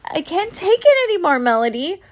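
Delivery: high, loud desperate shout; exasperated scream. A narrator's reading. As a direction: yell it over the thunder. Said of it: "Mom screamed over the thunder".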